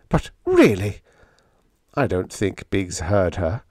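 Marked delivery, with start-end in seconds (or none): 0.1-1.0 high, stiffly offended. Acted. Helen objecting to Biggs's personal question, stiffly. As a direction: protest primly, pitch raised. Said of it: "she began stiffly".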